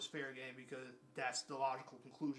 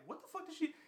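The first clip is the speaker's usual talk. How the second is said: disbelief; 12 semitones higher than usual. Retold or imitated. Inner disbelief.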